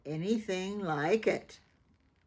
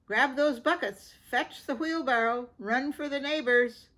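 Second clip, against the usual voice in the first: higher, louder rushed commands; urgent orders; 7 semitones higher, 5 dB louder than usual. A narrator's reading. Mom sending the kids running, urgent raised orders. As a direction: bark orders to get everyone moving.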